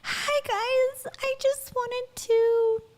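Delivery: very high, sweet forgive-me voice; high-pitched, cutesy, apologetic imitation; Rachel Hollis's child voice. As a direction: mimic a little-girl voice, sweetly pleading. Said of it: "forgive me voice".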